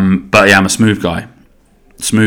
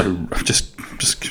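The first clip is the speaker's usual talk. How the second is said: whispered confession; 12 dB quieter, slower than usual. Real, not acted. Confessing to listeners he feels gassy, whispered. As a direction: whisper it, slightly embarrassed. Admitting feeling burpy.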